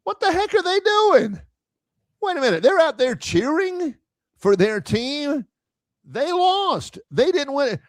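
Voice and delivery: mock baffled fan, pitch up; incredulous Bama fan. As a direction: sneer in disbelief at the cheering rivals. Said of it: "Are you saying".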